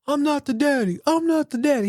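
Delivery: high, put-on talk-show guest denial; Maury show guest. Richard denying the baby on Maury, mock talk-show voice. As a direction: ham up the not-the-daddy denial.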